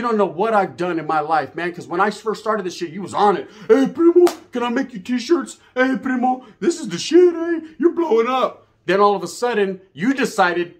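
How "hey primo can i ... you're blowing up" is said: high, whiny mock flattery; sing-song groveling cousin, hammed up. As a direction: ham up a begging suck-up voice. Mimics cousin begging for favors.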